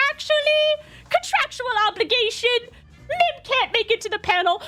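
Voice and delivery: high prissy official voice; mock officious staff. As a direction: recite the rule smugly. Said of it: "the convention staff were like".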